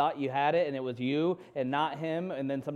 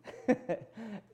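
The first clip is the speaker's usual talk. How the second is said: frustrated laugh; 7 semitones higher, 4 dB quieter than usual. Real, not acted.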